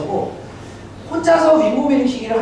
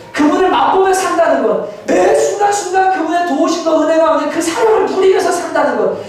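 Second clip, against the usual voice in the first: high, forceful sermon building to a shout; impassioned preaching; 9 semitones higher than usual. Real, not acted. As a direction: drive each point harder and higher to the congregation. Preaches at rising full force. (점점 고조되어 외치는 설교)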